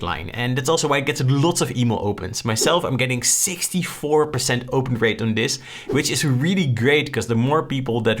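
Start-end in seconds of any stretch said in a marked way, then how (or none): none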